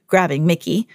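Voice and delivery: plain reading voice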